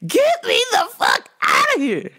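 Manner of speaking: high mock-desperate cry; exasperated wail. Clerk desperate to escape the customer, high mock wail. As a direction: beg for escape, hammed-up and high.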